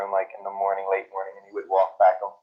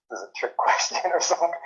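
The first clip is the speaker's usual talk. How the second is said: amused disbelief; 9 semitones higher than usual. Retold or imitated. Retold thought, breaking into laughter.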